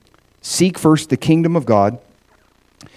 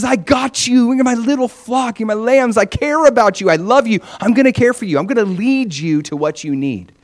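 higher, loving reassurance; tender Jesus; 8 semitones higher than usual. Acted. Jesus telling his lambs he loves them, tender and higher. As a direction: promise care like a gentle shepherd. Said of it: "can you hear the tenderness in Jesus' voice".

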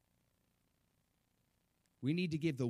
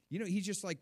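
usual speaking voice

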